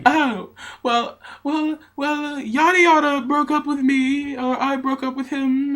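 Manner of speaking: put-on flustered drunk girl. Drunk girl voiced in story.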